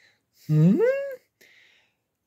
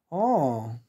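ordinary delivery, nothing marked

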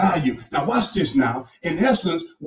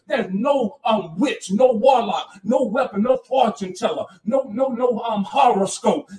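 raised, ringing preacher's cadence with hallelujahs; preaching at full force; 6 semitones higher than usual. Acted. Pastor rallying his church against discouragement, full-force preaching. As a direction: drive it home to the congregation.

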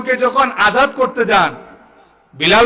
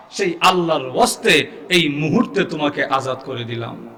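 hushed solemn declaration; 7 semitones lower, 7 dB quieter than usual. Retold or imitated. Retold solemn freeing of slave.

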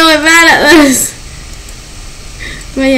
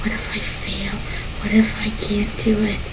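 much softer, fast whispered worry; scared whisper; 6 semitones lower, 12 dB quieter, faster than usual. Real, not acted. Frightened player whispering her doubts mid-game. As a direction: whisper the fear to herself. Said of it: "I'm so terrified".

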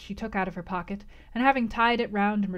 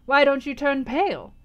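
higher, louder crone question; 5 semitones higher, 6 dB louder than usual. Acted. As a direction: push the challenge.

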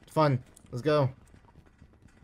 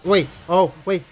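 ordinary talk, nothing marked

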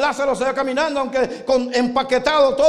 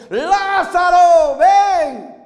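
slow booming shout, much louder and higher; raised voice; 6 semitones higher, 7 dB louder, much slower than usual. Acted. Jesus calls Lazarus out, loud and drawn out. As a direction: call the name across the grave. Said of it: "alzó la voz".

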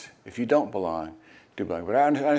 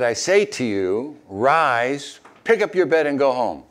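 commanding; 4 semitones higher, 6 dB louder, slower than usual. A narrator's reading. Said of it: "He said".

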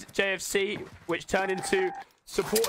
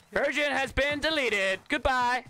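high, slow, drawn-out proclamation; mock announcer; 5 semitones higher, slower than usual. Player announcing a finished kill, mock announcer voice. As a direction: proclaim the kill grandly, word by word.

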